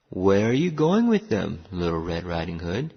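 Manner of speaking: low wolf question, lightly voiced; gruff wolf. A narrator's reading. Wolf asking where she is going, gruff voice. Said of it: "a gruff voice said".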